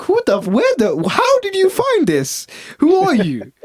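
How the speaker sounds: high, slow incredulous who-are-you; mock disbelief at a stranger; baffled outburst. To the foreign customer, baffled and high. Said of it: "I was like".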